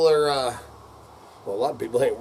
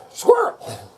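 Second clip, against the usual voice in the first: sudden high squeal; squirrel outburst; 3 dB louder than usual. Real, not acted. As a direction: blurt it out, distracted.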